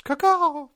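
loud high bird call; chirping bird person. Pretend. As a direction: squawk it out like a jungle parrot. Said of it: "like a bird in the Amazon rainforest".

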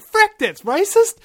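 loud, high, slow mock outrage; hammed-up shocked cry; retold outburst. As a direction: blurt it out, scandalised. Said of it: "and I went".

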